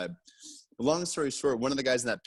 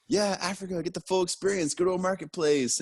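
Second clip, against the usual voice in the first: higher, hammed-up excited tourist; mock eager cheer; 9 semitones higher than usual. Traveler mocking his own naive excitement, high mock cheer. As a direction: play it naively thrilled, pitched up.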